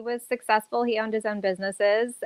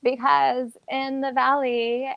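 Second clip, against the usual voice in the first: normal talking voice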